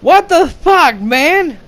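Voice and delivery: high, loud what the fuck, outraged; voicing her stunned disbelief; hammed-up indignant girlfriend; shocked outburst. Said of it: "she stops ... and says".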